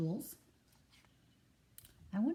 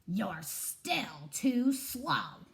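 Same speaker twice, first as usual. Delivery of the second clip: very slow, lumbering put-down; lumbering buffalo turning the squirrel away; dismissive buffalo; much slower than usual. Acted. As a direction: let each word plod heavily. Buffalo again.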